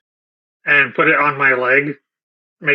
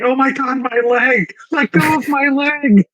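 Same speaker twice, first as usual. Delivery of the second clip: high-pitched panicked cry for help; hammed-up high yelps of alarm; mock screaming; 10 semitones higher than usual. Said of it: "Lather's just gonna start screaming".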